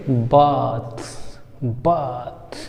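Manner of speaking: बाथ with long open aa, said softly; soft teacher demo of बाथ. Shown as a demonstration. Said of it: "क्या बोलेंगे?".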